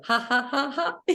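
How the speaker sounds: high, loud belly laughter; hearty laugh. Shown as a demonstration. Leader laughs while pretending to shake hands. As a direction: laugh freely and long. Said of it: "Let's all go".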